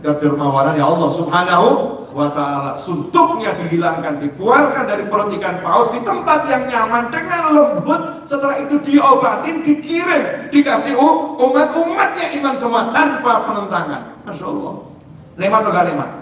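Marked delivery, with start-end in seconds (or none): 4.2-14.0 much higher, half-sung, rising with joy; fervent preaching. Acted. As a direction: lift the congregation in song-like fervour. Preaching rises to song.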